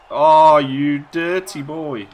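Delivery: loud, drawn-out admiring cry; very slow loud praise; delighted slow exclaim. Real, not acted. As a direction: savour the nasty move. Celebrating a dirty move.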